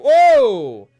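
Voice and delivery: loud high whoa, falling away; startled shout at the replay; surprised. Real, not acted. Streamer reacting to the hit, startled shout.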